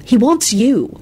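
mild reproof; stern. Acted. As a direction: insist sternly. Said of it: "Elizabeth said sternly".

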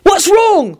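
high, anxious call; worried yell. A narrator's reading. William to Quentin, worried yell. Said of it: "William yelled out worriedly".

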